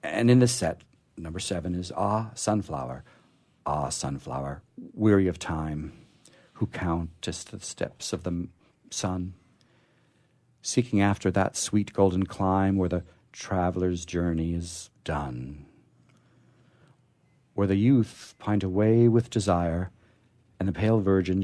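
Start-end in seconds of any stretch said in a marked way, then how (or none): none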